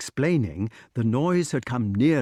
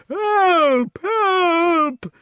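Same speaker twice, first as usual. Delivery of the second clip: very high, loud squeals for help; high wailing cries; terrified squeal; 6 dB louder than usual. A narrator's reading. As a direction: squeal it like a bitten giant. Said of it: "squealed the giant".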